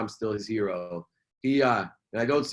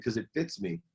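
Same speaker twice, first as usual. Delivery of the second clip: high, tearful and choked, softer; crying, choked up; 6 semitones higher, 6 dB quieter than usual. Retold or imitated. Telling his wife why a jacket makes him cry, through tears. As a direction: push the words out through tears. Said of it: "Why are you crying?".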